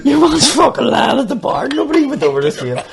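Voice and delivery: high, loud words through laughter; cracking up mid-story; laughing hard. Real, not acted. Telling punchline, laughing hard.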